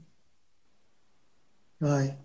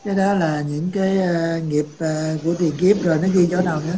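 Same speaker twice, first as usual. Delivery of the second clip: speaking up; 5 dB louder than usual. Real, not acted. Speaking up over a bad line.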